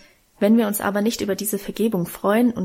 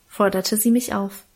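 plain reading voice

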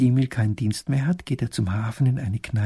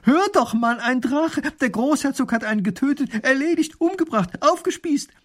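breathless excitement; 13 semitones higher, 5 dB louder than usual. A narrator's reading. Wife blurts news, rising.

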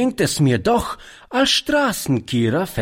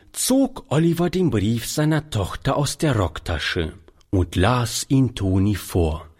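plain reading voice

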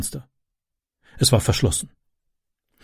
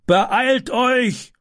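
high urgent yell; man urging helpers at a fire; neighbour shouting; 11 semitones higher than usual. A narrator's reading. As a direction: hurry everyone up. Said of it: "rief er".

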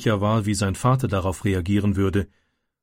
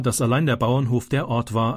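ordinary delivery

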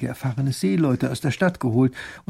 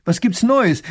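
higher and louder, impatient for news; eager little duke; 9 semitones higher, 8 dB louder than usual. Acted. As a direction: burst out asking for news. Duke greets arrivals eagerly.

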